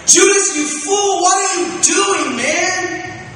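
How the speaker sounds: loud, high mock scolding, words drawn out; condemning outburst. Imagined Jesus condemning Judas, loud mock scolding. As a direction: act out an exasperated, condemning shout. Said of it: "where he looks at him like".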